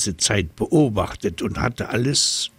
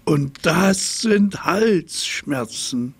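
slow, high, drawn-out giraffe pronouncement; proud giraffe; 8 semitones higher, slower than usual. A narrator's reading. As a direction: stretch it out as the final word. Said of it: "erklärte sie".

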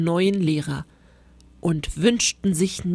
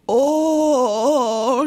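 long, raised, loud "ohhh"; awed groan; 6 semitones higher, 5 dB louder than usual. A narrator's reading. Second class sees the monster, long loud groan. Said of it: "stöhnte die ganze zweite Klasse".

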